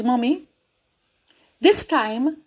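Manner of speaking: usual speaking voice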